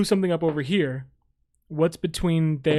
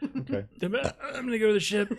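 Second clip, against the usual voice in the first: laughing; 6 semitones higher, slower than usual. Real, not acted. Joking announcement amid laughter.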